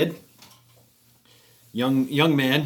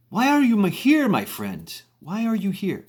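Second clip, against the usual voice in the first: attempted Indonesian accent; 8 semitones higher than usual. Tam questioning Steve at the bar, rough Indonesian accent. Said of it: "Indonesian accent".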